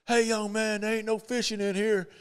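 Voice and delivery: half-playful warning to a stranger on his dock; Arnold Palmer shooing a kid. Retold or imitated. Palmer scolding an unknown kid off his dock, imitated. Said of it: "and he says".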